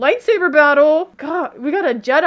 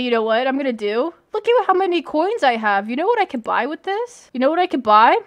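ordinary talk

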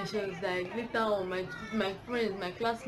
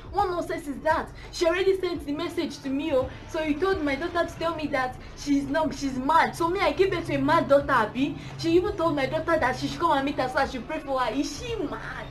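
raised, high-pitched indignant tirade; mock angry rant; 8 semitones higher, 7 dB louder than usual. Mother indignant that her daughter was called mad, loud acted rant. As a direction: play an offended mother shouting down the phone. Mother ranting on phone, voiced.

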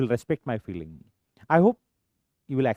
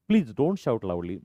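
slow model phrase; 3 semitones lower, much slower than usual. Shown as a demonstration. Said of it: "teacher models slow phrase".

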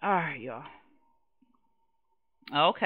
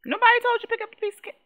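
higher, fast and exasperated repeat; sharper scolding; 14 semitones higher, 5 dB louder, much faster than usual. Acted. Mom scolding the kid again, higher and faster. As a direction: snap it out faster the second time.

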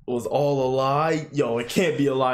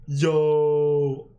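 ordinary delivery

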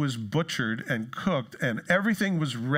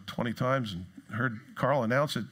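usual speaking voice

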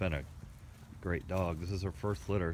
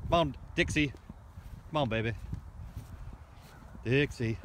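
shouting over wind; 7 semitones higher than usual. Real, not acted. Calling dog over wind.

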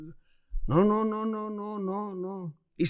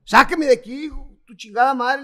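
loud, higher cursing demand to the guards; retold yell to get out; retold furious demand; 5 semitones higher, 7 dB louder than usual. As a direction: shout it as if dragged off. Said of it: "le dije".

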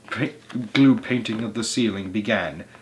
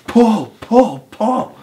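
high, loud repeated yells; yelling; 11 semitones higher, 7 dB louder, much slower than usual. A narrator's reading. Mugglewump drives everyone like a demon, yelling. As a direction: urge the haul with each yell. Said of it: "yelled Mugglewump".

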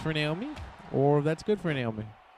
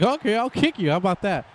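higher, louder cocky retort for Naomi; cocky comeback; 7 semitones higher, 6 dB louder than usual. Retold or imitated. Commentator voicing Naomi answering the taunt, cocky and higher. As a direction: toss back a mock threat with a grin. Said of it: "Naomi's like".

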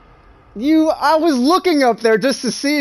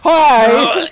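ordinary talk, nothing marked

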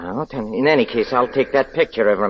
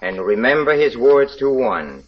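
preaching; 4 semitones higher than usual. Acted. Villain recites his sermon.

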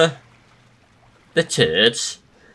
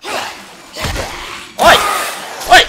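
high sharp shout; loud oi; 4 dB louder than usual. Real, not acted. Gamer spots an intruder, sharp high shout. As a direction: call them out hard. Calling out at enemy.

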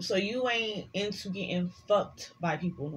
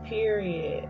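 usual speaking voice

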